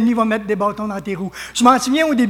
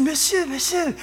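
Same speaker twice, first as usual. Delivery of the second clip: higher, softer mock French listener; imitated French woman; 6 semitones higher, 5 dB quieter than usual. French woman stopping the preacher, lightly imitated. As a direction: imitate a woman politely calling for attention. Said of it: "Elle dit".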